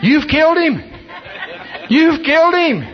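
high-pitched retold scream; screaming in alarm. Aghast doctor to the preacher who punched his patient, screaming. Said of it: "starts screaming out".

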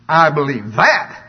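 slow and loud, rising sharply on THAT; sarcastic disbelief. Shown as a demonstration. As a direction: draw it out into a doubting question on "that". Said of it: "and I said it like this".

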